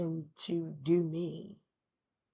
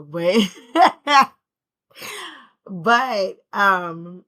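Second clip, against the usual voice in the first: laughing; 3 semitones higher, 15 dB louder, much slower than usual. Real, not acted. Bursts out laughing mid-sentence.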